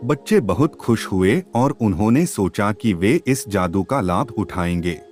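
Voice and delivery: ordinary delivery, nothing marked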